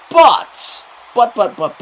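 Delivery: loud, high burst of buts; exclaimed but. Real, not acted. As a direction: shout it out to turn the story round. Loudly interrupts himself with repeated buts.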